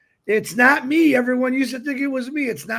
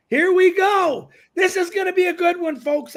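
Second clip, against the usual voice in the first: excited kickoff; 8 semitones higher, 4 dB louder than usual. Real, not acted. Host kicks off the show.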